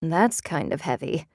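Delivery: with a gusty sigh. A narrator's reading. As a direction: sigh the words out. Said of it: "Cynthia said with a gusty sigh".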